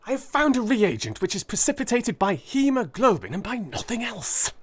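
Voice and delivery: lightly voiced eager scientist; a hint of a keen young chemist; eager Holmes. Holmes explaining his new reagent, eagerly.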